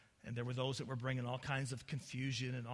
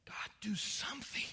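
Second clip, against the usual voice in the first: urgent whisper; desperate whispered plea; 8 semitones higher than usual. Acted. Jeremiah begs God to act, in a whisper.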